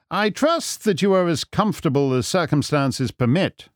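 a hint of airy sleuth; polite, composed Holmes. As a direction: inquire with mock solicitude. Holmes courteous to bound prisoner.

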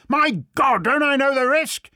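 loud, high-pitched angry outburst; roaring. A narrator's reading. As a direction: roar back in exasperation. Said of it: "he roared".

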